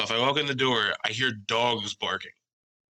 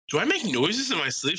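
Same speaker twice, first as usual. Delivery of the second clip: raised-pitch mock puzzlement; puzzled retold question; 8 semitones higher than usual. Husband asking partner about his sleep, puzzled. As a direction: ask it high, as if baffled.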